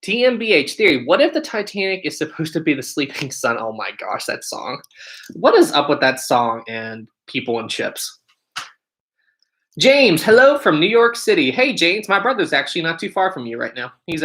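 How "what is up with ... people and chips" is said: usual speaking voice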